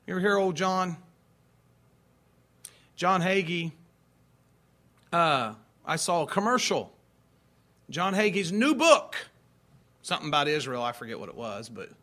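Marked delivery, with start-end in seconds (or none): none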